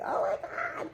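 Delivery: unvoiced teary oh my God; a breathless, tearful hush; choked whisper. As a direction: whisper it into your hands, overcome. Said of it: "hands in my face".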